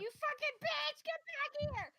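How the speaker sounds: loud, high furious shout; angry yell. Acted. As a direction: scream at the fleeing enemy. Yelling at fleeing enemy.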